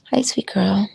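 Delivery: soft whispered greeting. Real, not acted. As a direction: whisper it tenderly to the horse. Greeting the horse softly.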